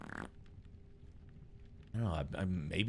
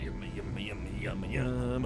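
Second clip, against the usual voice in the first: frog impression; 5 dB quieter, slower than usual. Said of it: "been doing frog impressions".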